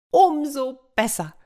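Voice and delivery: high, gleeful cry; delighted hare. Acted. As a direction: sound thrilled. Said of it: "rief der Hase erfreut".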